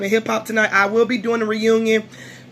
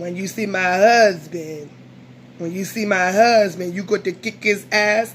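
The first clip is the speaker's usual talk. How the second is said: imitating a former castmate; 3 semitones lower than usual. Vlogger mimics old girl from last year, in a lower put-on voice. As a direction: imitate her talk, stretching the words low. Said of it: "she would talk like this".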